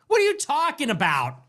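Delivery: usual speaking voice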